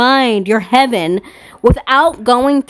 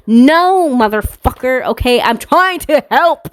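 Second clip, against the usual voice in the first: exasperated, raised-pitch retort; real frustration pushing the voice up; mad; 5 semitones higher than usual. Real, not acted. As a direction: snap back, heated and high. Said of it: "I'm just so mad".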